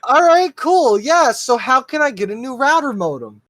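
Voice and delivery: sarcastic. Retold or imitated. Sarcastic reply to obvious answer.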